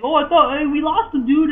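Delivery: high, loud imitation of a frantic game hero; excited mock exclamation. Player acting out the game heroes' banter for friends, excited mock alarm.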